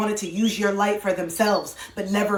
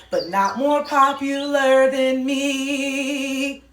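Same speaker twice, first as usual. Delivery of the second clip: Galinda impression; 3 semitones higher, much slower than usual. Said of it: "she was like (Galinda)".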